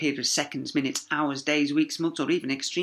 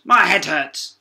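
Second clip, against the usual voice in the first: louder, whiny complaint; 3 semitones higher, 8 dB louder, slower than usual.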